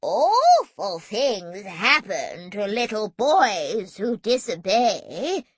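put-on slow, swooping old-lady warning; placid quavering granny. A narrator's reading. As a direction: quaver a threat quite placidly. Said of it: "Super Granny quavered placidly".

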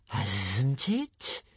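hissed question sliding upward; delighted creature. A narrator's reading. Monster learning it outdoes its rival, delighted hiss. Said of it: "asked the creature delightedly".

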